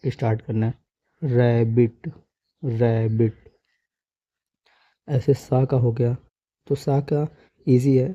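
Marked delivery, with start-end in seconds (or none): 1.2-3.6 "रैबिट" begun on the "ra" sound, "rae-bit"; careful teaching model of "रैबिट"; correct pronunciation demo. Shown as a demonstration. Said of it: "रा से start".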